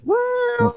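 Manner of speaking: high drawn-out "wow" wail; imitated creature cry. Showing how the limping cat-like man sounds, high imitated wail. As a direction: imitate the cat-man's high, stretched howl. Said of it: "he sounds like".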